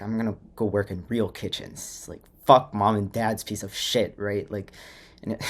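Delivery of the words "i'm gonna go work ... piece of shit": loud, heated defiance; fired-up, recalled rebellious boast; defiant. Retold or imitated. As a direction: build to a loud, scornful dismissal. Voices old defiant plan against family.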